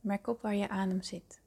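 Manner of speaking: hushed and calm, breathy at times; soft spa whisper. Acted. Leads a guided meditation.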